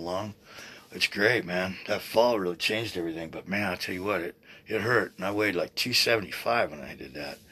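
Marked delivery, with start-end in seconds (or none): none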